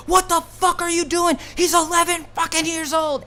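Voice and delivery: high, loud outraged shout; dad screaming at the cops arresting his eleven-year-old; furious dad yelling. Retold or imitated. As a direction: yell at the cops like a father protecting his son.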